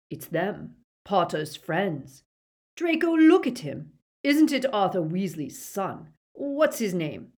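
urgent, building recognition; father prodding his son; excited Lucius. A narrator's reading.

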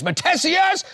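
high calling shout across a crowd; shouted name. Retold or imitated. Principal calling the student's name across the church. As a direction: call him out from thirty feet.

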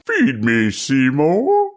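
a hint of the hungry plant; plant monster voice. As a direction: growl it like the musical's plant. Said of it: "we stepped into the middle of Little Shop of Horrors".

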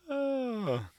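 ordinary talk

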